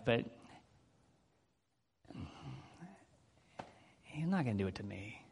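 low, soft, resigned admission; doubting. Retold or imitated. Preacher confessing his own weak faith, low quiet doubt. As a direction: voice the private doubt quietly, sinking at the end. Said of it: "I doubt he can do that for me".

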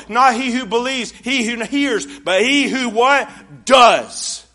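loud, high, fervent preaching; full-force pulpit warning. Acted. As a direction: drive it home to the congregation. Preaching the verse.

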